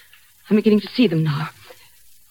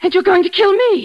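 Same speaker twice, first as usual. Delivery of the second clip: terrified; 11 semitones higher than usual. Acted. Realizing she is next.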